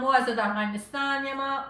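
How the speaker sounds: normal talking voice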